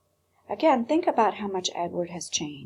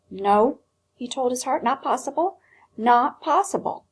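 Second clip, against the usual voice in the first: louder, insistent refusal; stubborn, louder no; firm denial; 5 semitones higher, 5 dB louder than usual. A narrator's reading. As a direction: talk himself out of hoping. Edward refuses hope.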